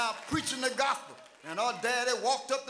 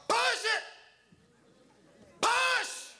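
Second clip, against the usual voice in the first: high-pitched, punched birth-room cry; hollered; 11 semitones higher, much slower than usual. Acted. People urging a woman in labor to push, hollering. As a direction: holler each push as an urgent command. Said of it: "and they holler".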